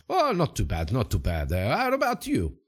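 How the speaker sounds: lilting mock Italian accent, swooping up. Sidekick greeting his friend back, bouncy Italian accent. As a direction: answer in a put-on Italian accent. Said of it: "I'm speaking like an Italian accent".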